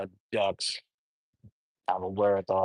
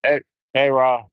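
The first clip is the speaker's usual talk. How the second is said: calling out; 3 semitones higher, 8 dB louder than usual. Real, not acted. Calling out to someone.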